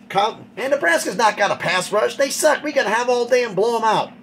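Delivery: a hint of a gloating rival fan; mocking Colorado fans. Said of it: "I had some Colorado fans who were talking to me".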